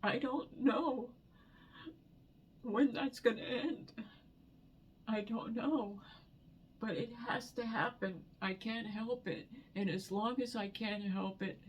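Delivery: words squeezed out through real tears; crying. Real, not acted. Crying over husband's death.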